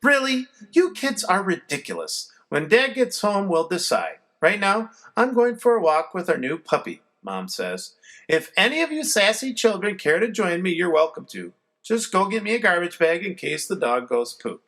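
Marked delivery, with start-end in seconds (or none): 0.0-7.0 higher, fed-up mother's voice; a mom rolling her eyes at her kids; mother scolding silly kids; exasperated mom. A narrator's reading. Mom's voice in the story. 8.3-14.6 higher, fed-up mother's voice; a mom rolling her eyes at her kids; mother scolding silly kids; exasperated mom. A narrator's reading. Mom's voice in the story.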